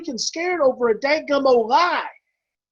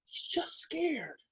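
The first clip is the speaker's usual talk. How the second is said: whisper; 3 semitones higher, 11 dB quieter, much slower than usual. Acted. Coach softens to make a point.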